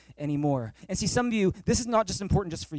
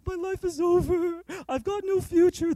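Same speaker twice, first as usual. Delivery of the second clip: imitating a depressed teen; 10 semitones higher than usual. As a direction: play the despairing kid, pitched way up. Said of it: "he was very depressed".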